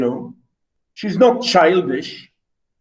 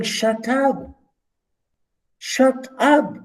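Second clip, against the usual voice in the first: rude quote; 8 semitones higher, much slower than usual. Shown as a demonstration. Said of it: "especially when he's saying".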